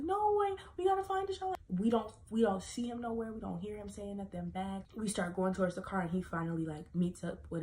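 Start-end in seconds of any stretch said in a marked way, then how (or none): 0.0-1.5 much higher, louder pleading; worried insistence. Retold or imitated. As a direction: refuse to leave without him. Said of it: "she's like".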